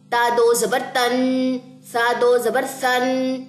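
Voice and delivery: slow, drawn-out qaida reading; slow recitation. Shown as a demonstration. Teacher models qaida reading for students, slow and clear. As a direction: read each syllable slowly for the class. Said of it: "like this۔".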